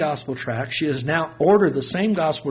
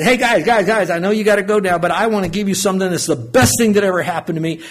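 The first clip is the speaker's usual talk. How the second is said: loud, higher call to get attention; 5 semitones higher, 6 dB louder than usual. Retold or imitated. Son calling out to gangbangers in his car, loud and higher. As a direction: raise the voice to stop the group.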